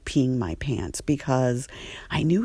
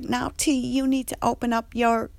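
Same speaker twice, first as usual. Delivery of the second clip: higher, sassy instruction; Andre's voice; 6 semitones higher than usual. Retold or imitated. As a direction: staff member telling T to open her dresser. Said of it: "And Andre's like".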